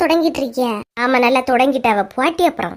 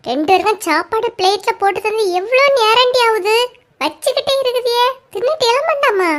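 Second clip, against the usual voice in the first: scolding mother; 6 semitones higher than usual. Acted. Mother scolding slow eaters.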